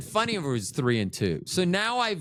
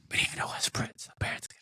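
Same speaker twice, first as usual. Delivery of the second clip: a real hushed whisper; 8 dB quieter, faster than usual. Real, not acted. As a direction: drop to a breathless whisper, as if a secret. Aside he keeps from listeners.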